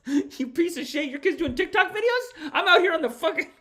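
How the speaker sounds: very high mock yelling with laughter; hammed-up rage. Streamer as the soldier raging about TikTok kids, laughing mock fury. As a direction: rant in squeaky-high outrage while cracking up.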